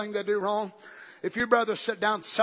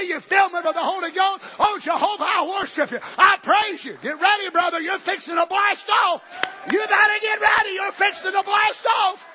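loud, higher, rising to a pulpit shout; shouting preacher; 7 semitones higher, 4 dB louder than usual. Acted. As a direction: whip the congregation up to blast off. Preaching at full force.

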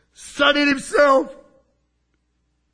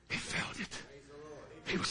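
He felt it. breathed as a whisper; 9 semitones lower, 19 dB quieter than usual. Shown as a demonstration. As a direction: whisper it to the room. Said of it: "He'd never heard his voice".

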